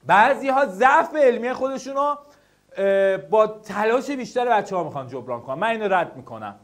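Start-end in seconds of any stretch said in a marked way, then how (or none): none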